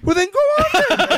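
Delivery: very high sarcastic officer voice breaking into laughter; laughing mock officer. Sergeant waving off a soldier, very high and laughing. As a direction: dismiss him with a giggling sneer.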